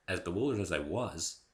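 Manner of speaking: plain reading voice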